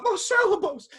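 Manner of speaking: desperate cry. Retold or imitated. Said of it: "I was like".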